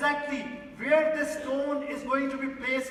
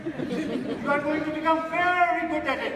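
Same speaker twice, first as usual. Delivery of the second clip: louder, higher, driving the point home to the hall; forceful preaching; 6 semitones higher, 7 dB louder than usual. Acted. Speaker warns the audience about practicing anger, preaching loudly.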